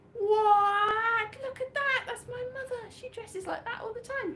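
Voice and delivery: high, squeaky mock surprise; hammed-up astonishment at the photo; mock shock. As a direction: play it as a scandalised gasp. Showing a photo of his mother.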